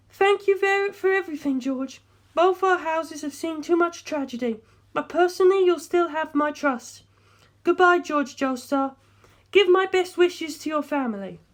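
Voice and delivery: put-on high, subdued young woman's voice; lifted female voice, polite and resigned; young woman's farewell. A narrator's reading. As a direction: say goodbye while holding yourself together. Darlington's subdued goodbye.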